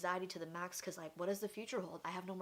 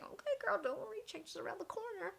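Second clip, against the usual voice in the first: much higher, lightly voiced reassuring God; God's voice; 13 semitones higher than usual. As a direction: play God comforting her with a high put-on voice. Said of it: "then God's like".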